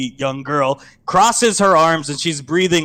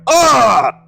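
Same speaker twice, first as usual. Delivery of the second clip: sharp pained grunt, higher and louder; a hit-the-wall grunt; 10 semitones higher, 9 dB louder than usual. Acted. Hunk slamming into the elevator wall, pained grunt.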